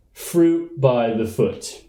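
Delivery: drawn out very slowly; slow emphatic climax. Acted. A candidate drawing out his top lunch item very slowly. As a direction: savour every word like a campaign promise.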